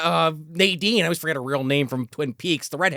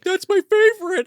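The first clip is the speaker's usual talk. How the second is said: high, louder hammed-up gush; high mock Rita; 14 semitones higher, 4 dB louder than usual. Rita gushing to Max about fusilli, high and hammy. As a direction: gush high like a smitten woman. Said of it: "She's like".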